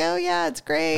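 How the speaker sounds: high, slow hollow agreement; feigned enthusiasm. Retold or imitated. Wife answering admirers, feigned enthusiasm. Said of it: "I'm like".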